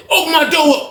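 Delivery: high-pitched demand called through a door; calling out. Retold or imitated. Inmate calling for his cell to open, high holler.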